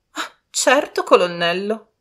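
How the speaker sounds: high, loud haughty lady; indignant cry. A narrator's reading. Lady Ingram answering the colonel, high loud cry. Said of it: "gridò Lady Ingram".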